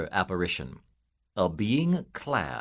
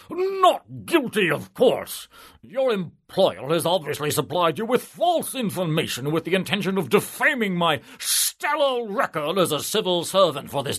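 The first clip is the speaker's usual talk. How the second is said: high, loud self-important politician; higher, louder pompous official voice; pompous civil servant; 8 semitones higher, 4 dB louder than usual. A narrator's reading. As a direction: puff up and boast of your stellar record. Pompous civil servant defends himself.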